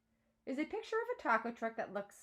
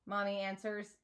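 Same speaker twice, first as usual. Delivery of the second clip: ordinary delivery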